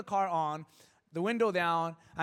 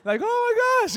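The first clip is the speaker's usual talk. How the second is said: shocked gasp; 9 semitones higher, 8 dB louder than usual. Retold or imitated. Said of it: "You're like".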